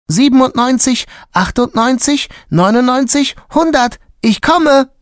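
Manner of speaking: put-on high, loud child's counting voice; young giraffe calling out. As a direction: count it out like an eager little kid.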